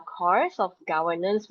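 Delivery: ordinary delivery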